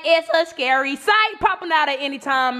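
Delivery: ordinary delivery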